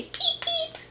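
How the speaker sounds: high squeaky peep peep; chick peeping. Pretend. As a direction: chirp it like a baby chick. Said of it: "They say".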